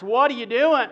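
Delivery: loud, high demand from a bystander; startled challenge. Acted. Bystanders challenging the disciples, loud and sharp. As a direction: call out sharply at the thieves.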